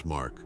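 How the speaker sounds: low, dropped, pointed "Mark."; pointed name drop. Acted. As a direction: tack "Mark." on with a low falling weight. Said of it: "Why do you keep saying my name after every sentence".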